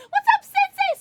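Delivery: very high, very loud squeal; squealed greeting. Real, not acted. Streamer greeting a viewer, high excited squeal. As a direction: greet them at the top of your register.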